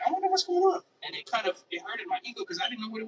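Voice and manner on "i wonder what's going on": higher, louder innocent wondering; high-pitched mock bafflement; puzzled self. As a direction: play it as your confused younger self. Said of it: "I was like".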